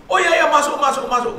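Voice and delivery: high, loud hurried invitation; flustered host. Retold or imitated. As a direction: imitate a flustered man ushering someone in. Flustered man inviting him in.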